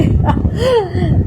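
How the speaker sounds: short falling laugh; a quick laugh at the comment; amused laugh. Real, not acted. Laughs after reading the comment.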